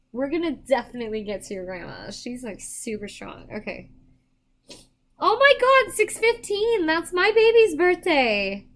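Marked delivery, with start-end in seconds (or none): 5.2-8.7 delighted, much higher and louder cry; excited. Real, not acted. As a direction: burst out with real joy. Recognizes her child's birthday.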